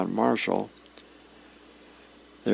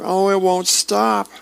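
high, drawn-out mock yell; comic-strip cry of alarm; acted yell; 9 semitones higher, 4 dB louder than usual. A narrator's reading. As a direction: wail it slowly up high, hammed-up. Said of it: "he yells".

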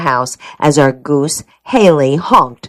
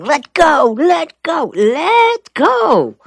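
high, strained mock shout, little added volume; acted shouting; 11 semitones higher, much slower than usual. A narrator's reading. Narrator acts out his shouting at the hose, high strained cry. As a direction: yell at a stuck hose, pitched way up. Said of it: "I had been shouting".

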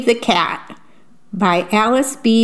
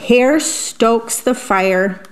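plain reading voice

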